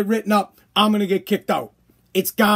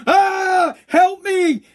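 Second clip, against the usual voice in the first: mock scream for help; 8 semitones higher, much slower than usual. Running down the street, a hammed-up scream for help. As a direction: cry out for help in mock panic. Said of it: "run down the street screaming".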